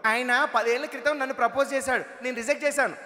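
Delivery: a bit smug, like a wife recalling a suitor; wife's voice. Retold or imitated.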